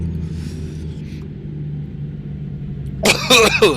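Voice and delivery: harsh choking cough; hacking cough; coughing fit. Real, not acted. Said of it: "I don't know why I'm choking".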